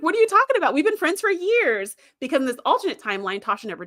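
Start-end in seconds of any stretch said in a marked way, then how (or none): 0.0-2.0 high, incredulous disbelief; surprised protest. Retold or imitated. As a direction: act out her shock at being forgotten. Tasha voiced, surprised protest.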